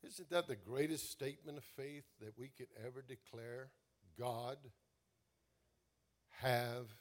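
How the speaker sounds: low, soft voice leading a congregation in prayer; hushed, reflective preaching. Real, not acted. Preacher asks congregation to trust God, hushed and reflective. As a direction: let it sink in quietly, with long pauses.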